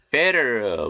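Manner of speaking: 'Better' with T as an R-like tap; American flapped T. Shown as a demonstration. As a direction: flap the T in 'Better'. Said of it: "연음 현상이 들어가서 이렇게". ('Better'의 T를 ㄹ처럼)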